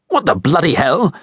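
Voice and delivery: high, startled angry yelp; an offended stranger snapping back; indignant outburst. A narrator's reading. Guy jolted, coffee spilled.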